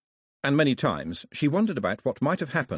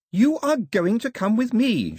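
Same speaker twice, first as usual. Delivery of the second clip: high, slow, emphatic shout; loud, high-pitched insistence; 13 semitones higher, 5 dB louder than usual. A narrator's reading. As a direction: belt out a command word by word. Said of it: "shouted Daphne".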